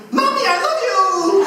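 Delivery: very high, loud, drawn-out squeal; adoring little girl. Retold or imitated. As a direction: shriek it like a child rushing to mommy. Acting girl rushing at new mommy.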